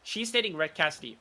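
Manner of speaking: normal talking voice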